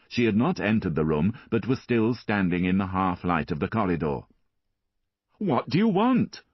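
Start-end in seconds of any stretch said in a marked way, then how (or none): 5.4-6.4 angry. A narrator's reading. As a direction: throw the question back angrily. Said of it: "Marius repeated angrily".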